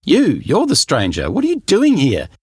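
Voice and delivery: high, loud alarmed shout. Acted. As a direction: demand answers at the top of the voice. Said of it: "I shouted".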